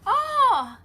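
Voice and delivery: high, loud surprised "oh"; delighted surprise. Real, not acted. Streamer notices a viewer's comment, pleasantly surprised. Spots another viewer greeting her.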